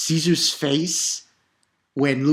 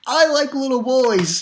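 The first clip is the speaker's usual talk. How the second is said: mock creep voice; 8 semitones higher, 5 dB louder, slower than usual.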